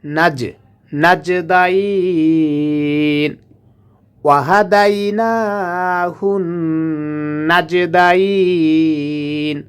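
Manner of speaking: নাজদাইন with a bounce on the জ, slow; qalqalah on jim. Shown as a demonstration. Teacher demonstrating the echoing jim. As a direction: echo the জ in নাজদাইন. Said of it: "এখান থেকে পাল্টা আওয়াজ হবে".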